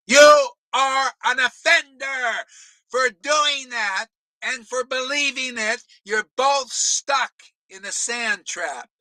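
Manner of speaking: yelling. Real, not acted. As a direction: shout it at the crowd of followers. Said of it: "Do I have to yell more?".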